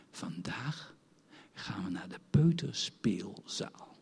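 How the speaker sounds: soft, slow whisper; whispered mother's words. Retold or imitated. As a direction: hush it like a secret from mama. Mother tells child about playschool.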